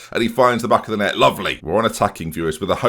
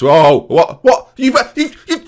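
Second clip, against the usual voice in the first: high, loud outraged shouting; furious, cracking exasperation; incredulous shout; 5 dB louder than usual. Real, not acted. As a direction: yell in disbelief, voice cracking. Opponent misses winning penalty.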